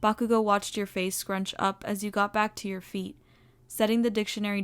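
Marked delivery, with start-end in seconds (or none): none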